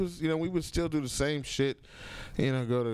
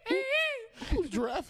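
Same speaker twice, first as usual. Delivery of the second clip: surprised; much slower than usual. Retold or imitated. Startled by a giraffe walking by.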